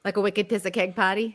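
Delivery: put-on broad Boston accent, the r's dropped. New Englander showing hosts a Boston accent. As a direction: toss it off like a real Boston local. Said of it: "unless you do it properly".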